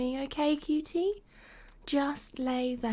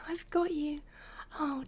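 ordinary delivery

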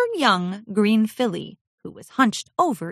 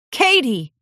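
higher, louder call to his wife; 7 semitones higher, 5 dB louder than usual. Acted. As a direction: call her name as you rush over. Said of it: "rushed over to his wife".

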